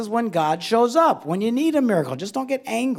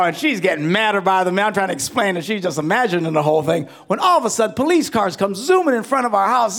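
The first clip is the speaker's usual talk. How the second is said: ordinary delivery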